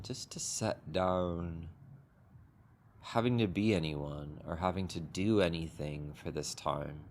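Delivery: unhurried, gentle meditation guidance with long pauses; guided meditation. Acted. Teacher guiding the group into a sitting meditation, calm and unhurried. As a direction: ease the group into stillness, one phrase at a time.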